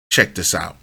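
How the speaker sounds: usual speaking voice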